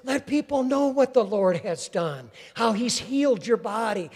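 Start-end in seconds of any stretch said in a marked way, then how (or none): none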